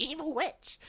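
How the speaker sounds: high-pitched mock witch voice; evil witch. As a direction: squeal it like a storybook witch. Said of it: "she was this mental goth girl".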